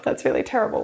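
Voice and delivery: ordinary talk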